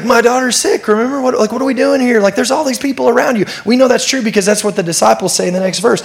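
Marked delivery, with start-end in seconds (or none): 0.0-3.4 high, urgent, pleading complaint; impatient Jairus. Retold or imitated. Jairus urges Jesus to hurry to his daughter, high and exasperated. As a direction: hurry him along like a worried dad. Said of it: "Jairus is going".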